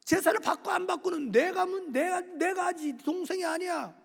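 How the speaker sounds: high-pitched, insistent scolding; God rebuking Cain. Acted. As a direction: reprove him firmly, pressing each point home.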